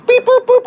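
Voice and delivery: high loud beeping noises; radio beeps. Retold or imitated. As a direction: make a high beep boop sound. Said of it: "you'd be like".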